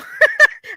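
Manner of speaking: high burst of relieved laughter. Real, not acted. Streamer laughing at J B in chat, relieved. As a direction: laugh the tension out.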